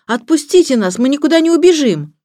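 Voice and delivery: put-on high, loud boyish protest; indignant. A narrator's reading. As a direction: demand to be let go. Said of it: "вознегодовал Сирил". (негодующий протест)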